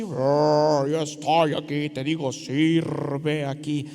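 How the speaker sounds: hammed-up voice of God from a cloud; mock heavenly pronouncement, low and drawn out; booming voice from the cloud. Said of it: "con una voz así".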